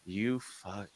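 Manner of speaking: soft, under-the-breath curse in retold anger; retold quiet anger. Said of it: "I was so mad".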